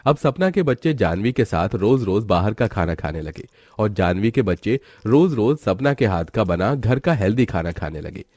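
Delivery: ordinary delivery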